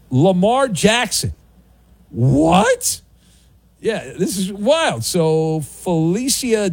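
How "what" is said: surprised. Real, not acted. Reacting to a surprising story.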